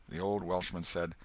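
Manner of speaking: ordinary delivery, nothing marked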